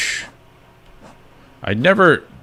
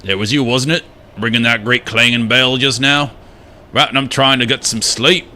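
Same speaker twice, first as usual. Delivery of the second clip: grumpy game character scolding an intruder; acted, indignant character voice; put-on character accent; 6 semitones higher, 3 dB louder than usual. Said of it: "what accent I'm doing for this guy".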